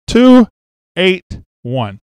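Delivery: high loud burst on the first word, then a deflated drop; shocked weight reveal. Real, not acted. Man reading his weight on the gym scale, stunned. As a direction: blurt the number in disbelief.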